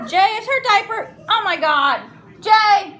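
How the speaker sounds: urgent shout for help, rising in force; real alarmed call. Real, not acted. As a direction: call out to your partner in sudden alarm. Calls partner as diaper falls.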